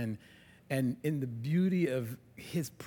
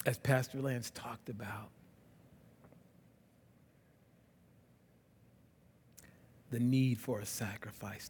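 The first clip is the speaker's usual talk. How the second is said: closing prayer; 6 semitones lower, 5 dB quieter than usual. Real, not acted. Pastor leading the church in closing prayer, soft and reverent. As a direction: pray quietly over the congregation.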